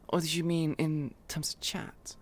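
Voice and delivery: unvoiced whisper, aside to the player; whispered question. Real, not acted. Player asks the streamer, whispering. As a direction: whisper it, out of character.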